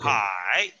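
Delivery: Hai low and dipping; third tone. Shown as a demonstration. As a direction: let Hai sink deep, third tone. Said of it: "Tercero ahí te va".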